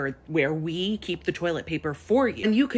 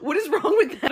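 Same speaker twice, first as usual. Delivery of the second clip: laughing exasperation; 10 semitones higher, 6 dB louder than usual. Retold or imitated. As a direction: laugh it out in disbelief. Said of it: "I was like".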